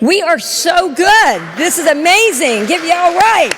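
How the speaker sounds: loud, high cheering praise; excited. Real, not acted. Speaker to audience, cheering praise after the chant. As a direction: celebrate the crowd.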